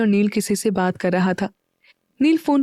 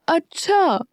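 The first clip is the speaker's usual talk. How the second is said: put-on teasing boyfriend; 8 semitones higher, much slower than usual. A narrator's reading. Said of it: "युवी उसे देखते हुए बोला".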